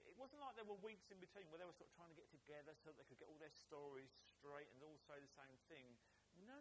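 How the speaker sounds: usual speaking voice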